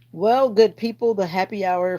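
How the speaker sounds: sign-off call. Real, not acted. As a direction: announce the goodbye to the chat. Sign-off to stream viewers.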